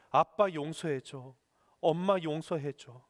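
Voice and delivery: humble slow plea, voice dropped; pleading for forgiveness. Shown as a demonstration. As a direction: ask forgiveness gently and slowly. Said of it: "이렇게 하시기 바랍니다".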